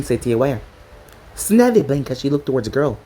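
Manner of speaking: usual speaking voice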